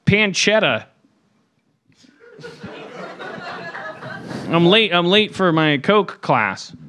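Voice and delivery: high, slow, airy LA voice; overheard LA quote. Retold or imitated. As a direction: play a self-absorbed LA type. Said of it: "I'll give you one".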